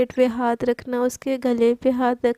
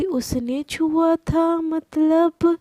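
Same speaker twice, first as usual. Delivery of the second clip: शक भरी धीमी आवाज़; 3 semitones higher, much slower than usual. Acted. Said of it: "एकदम घूर के देखती है और बोलती".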